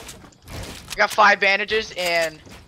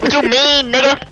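put-on loud, higher kid's challenge; mimicked outraged kid; 4 semitones higher, 6 dB louder than usual. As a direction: imitate an indignant kid shouting back. Mimicking kid in retold quarrel.

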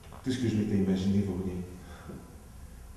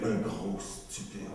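slow and much softer; muttered aside; 7 dB quieter, much slower than usual. Acted. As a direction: mutter it to yourself, slowly. Self-insult muttered.

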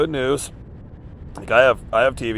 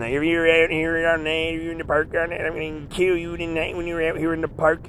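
higher, mimicking the monster's threat; menacing threat; 5 semitones higher, 4 dB quieter than usual. Homeless man retelling a stranger's threat, mock menacing voice.

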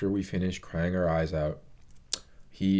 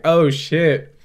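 loud, higher panicked cry; freaking out; 6 semitones higher, 8 dB louder than usual. Retold or imitated. Alan panicking as the light flickers, mock panic. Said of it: "Alan just starts to freak out".

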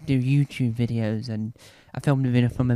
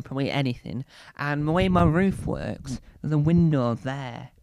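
ordinary talk, nothing marked

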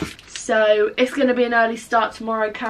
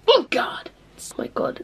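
excited whisper; 5 semitones higher, 4 dB quieter than usual. Real, not acted. Craving chocolate pretzels.

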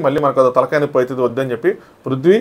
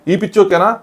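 ఈ పిచ్చి ఓకేనా pitched up higher, a mock outraged question; imitating a heated rising protest; raised-pitch indignant repeat; 6 semitones higher than usual. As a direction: push the repeat higher, like he can't believe it. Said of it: "నా pitch, నా voice sound".

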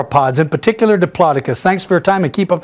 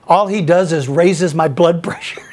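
normal talking voice

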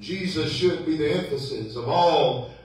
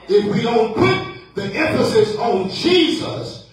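raised, drawn-out pulpit cadence; forceful preaching; 6 semitones higher, 4 dB louder, slower than usual. Acted. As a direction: ring out each key word to the church. Preaches at force after quiet aside.

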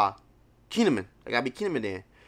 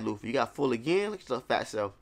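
ordinary talk, nothing marked

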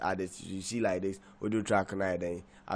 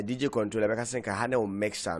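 ordinary talk, nothing marked

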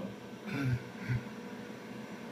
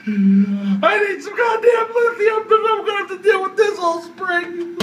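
high laughing yells; hysterical shouting; 11 semitones higher than usual. Real, not acted.

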